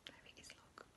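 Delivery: hushed whisper pointing. Acted. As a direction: whisper as if the shark could hear. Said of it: "pointing at the picture".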